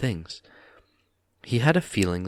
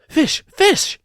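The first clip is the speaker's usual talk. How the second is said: much higher, loud shriek; excited cry; 9 dB louder than usual. A narrator's reading. Gollum guesses the riddle, shrieking with glee. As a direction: yelp it out in triumph. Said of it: "he cried".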